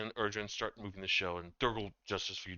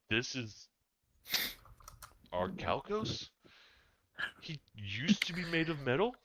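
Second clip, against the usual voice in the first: surprised; 7 semitones higher than usual. Acted. GM voices Erdron, surprised.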